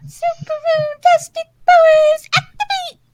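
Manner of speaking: put-on high, loud magical girl cry; bright magical girl. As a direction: shout the transformation call. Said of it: "and say".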